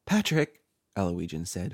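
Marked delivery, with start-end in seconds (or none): 0.0-0.6 higher, louder frightened call; shaking voice. A narrator's reading. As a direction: call out the name in fear. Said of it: "her voice shaking".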